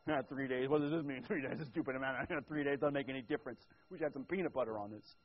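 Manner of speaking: a hint of a fed-up complainer; grumbling Israelite. Israelites voiced complaining about manna.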